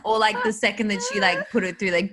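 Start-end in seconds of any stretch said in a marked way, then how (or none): none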